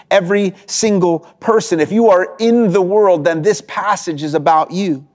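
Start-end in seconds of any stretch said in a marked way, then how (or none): none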